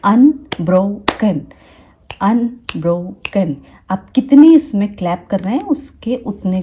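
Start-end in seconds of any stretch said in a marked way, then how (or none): 0.0-3.6 unbroken slowly split into अन, bro, कन; clap-along beats in unbroken, a gap after each syllable. Shown as a demonstration. Teacher shows parents how a child claps out unbroken, syllable by syllable. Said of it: "वो clap करके देख सकता है".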